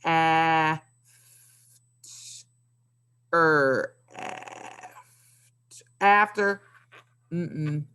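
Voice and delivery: slow, halting sounding out of after; mock struggling reader sounding out after. Shown as a demonstration. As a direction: stumble through the word bit by bit. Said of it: "We should not get on Seesaw Friday and say".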